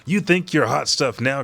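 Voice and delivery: taunting rival. Retold or imitated. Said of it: "And then she goes".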